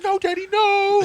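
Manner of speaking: put-on very high, loud child's wail; mock terrified pleading. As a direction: imitate a kid begging dad to stop. Brothers' pleading acted out.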